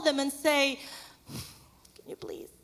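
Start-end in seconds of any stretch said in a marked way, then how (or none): 2.0-2.5 a soft unvoiced whisper asking for help; whispered plea. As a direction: breathe it out as a timid request.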